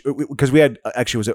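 ordinary delivery

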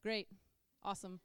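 low, soft, underwhelmed reaction; cool, unexcitable. Retold or imitated. Husband reacting to a lottery win, cool and unexcitable. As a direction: react with zero excitement, quiet and low. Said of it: "in his cool, like, you guys".

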